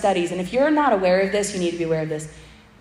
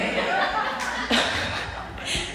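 a light breathy giggle; soft airy laugh; 11 semitones higher, 5 dB quieter than usual. Real, not acted. Preacher laughing after her makeup joke, light airy giggle. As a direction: giggle quietly at yourself.

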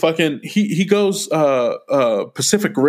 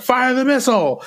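mock order; 9 semitones higher than usual. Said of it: "he's like".

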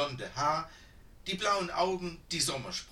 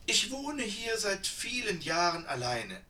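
put-on high, slightly louder old woman voice; chatty old woman; 7 semitones higher, 3 dB louder than usual. A narrator's reading. Old woman tells the sisters about her life, high and chatty. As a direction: ramble on like a lonely old woman.

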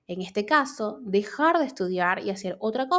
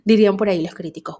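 usual speaking voice

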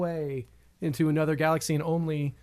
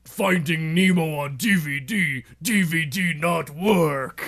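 slow, halting Hulk voice; 2 semitones lower, slower than usual. Acted. Comic pitching the Hulk for fun conversations, in Hulk voice. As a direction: talk like a slow, simple Hulk. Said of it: "I think the Hulk".

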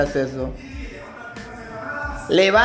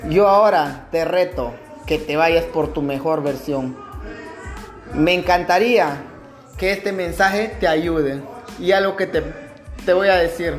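booming motivational speech; 5 semitones higher, 7 dB louder than usual. Acted.

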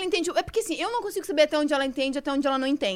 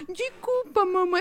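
put-on toddler apologising; 5 semitones higher, much slower than usual. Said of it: "ela ficou assim".

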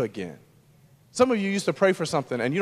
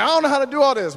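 higher-pitched, mildly raised voice, retelling a gripe; overwhelmed holler, only half played; mock loud complaint; 9 semitones higher, 4 dB louder, faster than usual. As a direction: imitate calling indoors to a wife. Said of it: "talking to my wife loudly".

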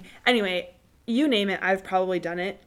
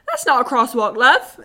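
loud, higher-pitched mock British; British accent; 4 semitones higher, 11 dB louder than usual. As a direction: blurt it out in a British accent, laughing at the end. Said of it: "I just said that in that accent".

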